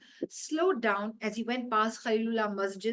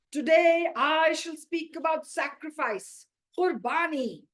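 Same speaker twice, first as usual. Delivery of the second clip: slow, louder higher sermon voice; solemn preacher; 6 semitones higher, 6 dB louder than usual. Acted. Mullah Nasruddin opens his sermon, slow and solemn. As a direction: orate like a mullah on a dais.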